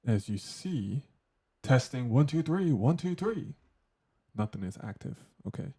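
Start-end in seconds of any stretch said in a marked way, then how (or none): 1.6-3.5 rising higher and louder; mic check growing to a yell; building loud mic test. Shown as a demonstration. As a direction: count up into a shout to test the compressor.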